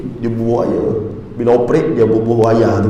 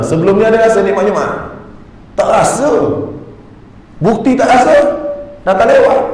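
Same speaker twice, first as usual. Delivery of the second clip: raised, high-pitched mock-incredulous challenge; challenging the congregation; 8 semitones higher than usual. Real, not acted. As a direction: confront the listeners, high and forceful. Menegur jemaah yang datang lewat. (menegur jemaah dengan suara tinggi)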